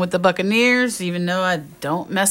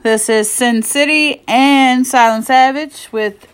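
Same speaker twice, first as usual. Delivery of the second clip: announcer intro; 7 semitones higher, 8 dB louder than usual. Acted. Show host opening intro.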